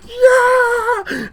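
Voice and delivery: high, loud joyful yell of victory; ecstatic cheer. Retold or imitated. Fan cheering as his idol finally wins, high loud yell. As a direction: leap up and cheer at the win. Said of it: "and I was like".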